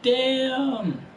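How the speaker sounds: high, drawn-out "damn"; mock shock. Driver reacting to Hemrick's insult, mock shocked. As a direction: act stunned, stretch the word up high. Acts own stunned reply to Hemrick.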